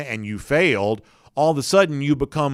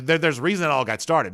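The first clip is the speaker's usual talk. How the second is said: ordinary delivery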